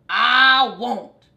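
dog shouting across the yard; shouted. A narrator's reading. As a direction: fling it back loudly. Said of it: "Dog shouted".

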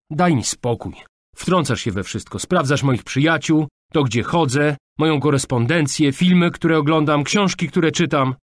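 put-on heated teenage tirade; exasperated outburst. A narrator's reading. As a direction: pile up the accusations, fed up. Daughter lists father's intrusions.

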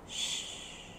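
unvoiced hushing shh; shushing. A narrator's reading. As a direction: hush the others. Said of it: "he said to the others".

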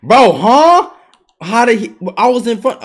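loud high shocked huh; indignant yelp; outraged surprise. Real, not acted. Outraged at a steal.

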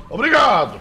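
loud, high sarcastic thank-you; mock thanks. Real, not acted. Gamer mocking the defeated enemy, loud sarcastic thanks. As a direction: belt out a cocky thanks.

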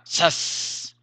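a fast breathy "Sas" like something zipping by; quick whoosh. Shown as a demonstration. Teacher demonstrating the sound of speed, whooshing. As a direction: snap it off like speed itself. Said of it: "señal de rapidez, velocidad".

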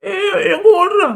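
high, slow shout after someone running off; angry shout. Acted. Uncle shouting after the fleeing boy, loud and high. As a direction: bellow at him to stop running.

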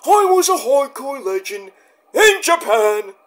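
Terry Funk boasting about Japan; Terry Funk impression. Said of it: "Terry Funk's a hard impression".

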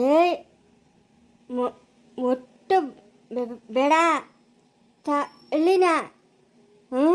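halting stutter, long gaps between words; laboured, emphatic stammer; stammered warning. Acted. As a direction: jab out each order after catching on it. Stammering man orders hands off thali.

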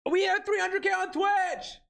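high, thrilled celebration yell; excited shout. Real, not acted. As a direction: shout the milestone out with joy. Streamer celebrates milestone.

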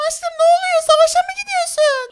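very high falsetto wife; squeaky put-on questioning spouse; wife's voice.

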